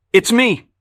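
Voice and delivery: shouting. A narrator's reading. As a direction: shout over a noisy bar. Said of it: "Evelyn shouts".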